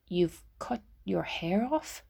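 slow, stunned question; a lightly voiced stunned husband, slow. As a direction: ask it slowly, still taking it in. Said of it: "asked Jim slowly".

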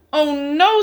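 high, fed-up bear voice; a tired bear at the end of his rope; Mister Bear groaning at the noise; weary Mister Bear. Acted. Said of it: "said Mister Bear".

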